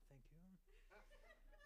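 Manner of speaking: breathy whispered thank you. Retold or imitated. Preacher whispers thanks to the flight attendant for the warm towel. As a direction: hush it out, awed by the towel.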